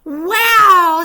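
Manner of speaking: a loud, amazed wow; astonished. Acted. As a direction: sound truly astonished.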